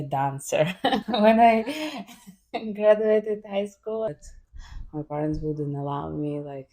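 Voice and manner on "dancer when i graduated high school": real laugh breaking through, louder. Real, not acted. Guest laughing as she admits her dancer dream. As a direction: giggle while confessing it.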